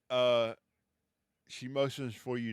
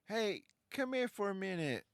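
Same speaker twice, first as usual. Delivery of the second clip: lightly voiced Dahlia, higher and calling over; a hint of a higher woman's voice, beckoning; Dahlia's voice; 10 semitones higher than usual. Dahlia calls the player over to her, lightly voiced higher. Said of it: "she goes".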